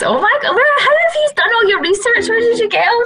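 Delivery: very high, laughing disbelief; high-pitched, giggly amazement; amazed. Real, not acted. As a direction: squeal it in delighted astonishment. Amazed at host's research.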